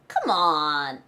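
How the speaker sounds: drawn-out falling scoff; skeptical, stretched "come on"; disbelieving come on. Shown as a demonstration. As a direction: say it like you don't buy it. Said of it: "무슨 느낌인지 알아요?".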